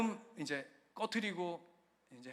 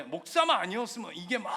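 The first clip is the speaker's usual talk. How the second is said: "목사만 아니었으면" shouted louder, 목사만 rising; rising anger; 5 semitones higher, 8 dB louder than usual. Retold or imitated. Pastor voicing his inner rage at the landlord, shouted. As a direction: let the rage burst out on 목사만. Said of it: "이게 막 올라오는 거예요".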